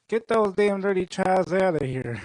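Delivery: mock Southern bigot's complaint; Southern accent. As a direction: gripe in a put-on Southern accent. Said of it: "once you attach a Southern accent to it".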